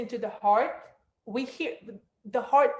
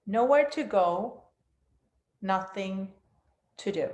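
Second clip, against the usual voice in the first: guided meditation. Acted. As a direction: guide them down into stillness.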